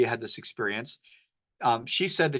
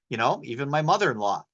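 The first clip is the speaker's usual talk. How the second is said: ordinary talk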